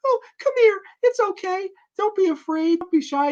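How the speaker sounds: put-on coaxing a child. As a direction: lure the frightened kid out gently. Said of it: "She's like".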